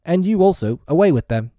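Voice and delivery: commanding. A narrator's reading. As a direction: dismiss them forcefully. Peter orders brothers taken away.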